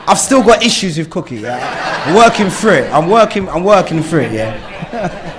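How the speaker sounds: words through chuckles; laughing through it; laughing while talking. Real, not acted. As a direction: joke at your own expense. Laughing at his own issues.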